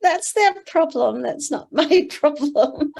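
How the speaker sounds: high, loud and breezy, laughing it off; carefree inner voice. Retold or imitated. Voicing her own thoughts about critics, high and carefree. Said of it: "I think".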